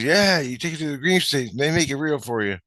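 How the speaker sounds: high-pitched imitation of a kid explaining; eager little kid. Kid explaining the store, imitated high eager voice. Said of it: "And he goes".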